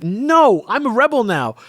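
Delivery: high-pitched, hammed-up refusal; mock defiance. Lando refusing the queen's money, mock defiant. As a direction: play a defiant newly made rebel.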